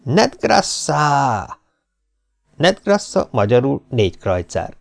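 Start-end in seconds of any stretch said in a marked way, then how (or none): 0.0-1.6 one long, stretched-out call; drawn-out showman's shout; triumphant vendor's cry. A narrator's reading. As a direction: hold the word out for the whole crowd. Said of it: "karját kinyújtva kiáltott".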